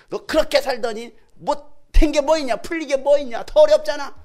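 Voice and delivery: high-pitched, very loud berating; yelled taunt. Retold or imitated. Bystander to a struggling believer, shouted scorn. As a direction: hammer them with loud scornful questions. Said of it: "한번 지르잖아".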